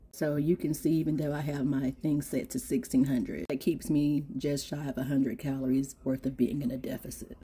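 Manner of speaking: low, soft explaining voice; quiet walkthrough. Real, not acted. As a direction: talk it through quietly.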